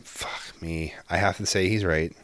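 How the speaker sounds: soft, defeated muttering to self; grudging admission. Retold or imitated. Retold grudging realization.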